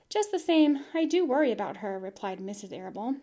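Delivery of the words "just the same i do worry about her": louder firm insistence; mother holding her ground; worried mother. Acted. Mother insists to husband about Fern, firm and louder.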